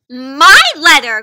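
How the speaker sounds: loud, slow, drawn-out claim; crying out. Acted. Squirrel grabbing it back, crying out loudly. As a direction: insist it's yours, loud and slow. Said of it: "cried Squirrel".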